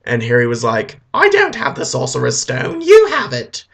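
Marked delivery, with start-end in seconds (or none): 1.1-3.6 put-on high-pitched, defensive boy's retort; indignant protest. Harry denying he has the stone, high indignant protest. Harry argues back at Voldemort.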